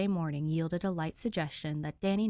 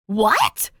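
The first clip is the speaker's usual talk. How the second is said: sharp loud demand; loud, demanding what; 5 semitones higher, 15 dB louder than usual. Acted. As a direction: bark the question out. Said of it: "Danny demands".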